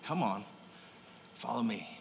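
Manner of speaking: hushed call to follow; gentle Jesus, almost murmured; tender invitation. Retold or imitated. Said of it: "Jesus leans in and says".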